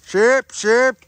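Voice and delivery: high, loud sing-song sheep call. Real, not acted. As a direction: holler for the flock, rising at the end. Calling for sheep uphill.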